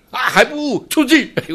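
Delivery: sudden high shouted scolding, half in jest; mock Chan master. Pretending to be a master driving out an unenlightened student, mock shout. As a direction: bark him out like a stern master, playfully. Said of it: "我也可以装一装啊".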